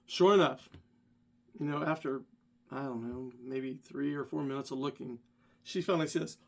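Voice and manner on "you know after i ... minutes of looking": normal talking voice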